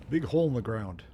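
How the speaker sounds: normal talking voice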